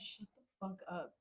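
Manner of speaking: hushed order. Retold or imitated. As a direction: act out silencing someone while hiding. Acted hushed order while hiding.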